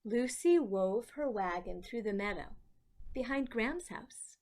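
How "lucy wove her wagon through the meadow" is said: usual speaking voice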